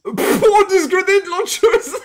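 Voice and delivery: high, loud, drawn-out, breaking into laughter; gleeful laughing. Real, not acted. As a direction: exclaim it loudly through laughter. Laughing at haul of weapons.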